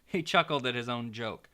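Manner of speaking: ordinary delivery, nothing marked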